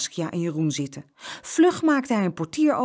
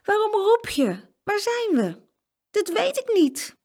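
put-on anxious children's voices; 4 dB louder, slower than usual. A narrator's reading. Children waking up lost in dark.

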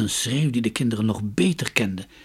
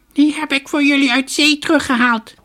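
parrot voice; 10 dB louder than usual. A narrator's reading. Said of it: "sprak de papegaai".

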